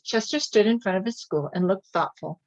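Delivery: ordinary delivery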